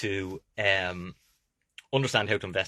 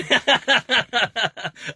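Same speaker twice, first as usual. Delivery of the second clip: loud laugh; 12 semitones higher, 7 dB louder than usual. Real, not acted. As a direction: burst of high laughter. Laughs at own joke.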